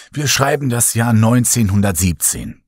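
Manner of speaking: usual speaking voice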